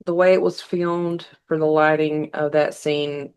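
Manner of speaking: ordinary delivery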